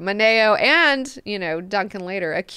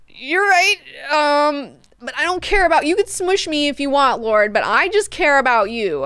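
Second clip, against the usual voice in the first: pleading high voice; 7 semitones higher, 4 dB louder than usual. Acted. Moneo pleading after Leto's threat.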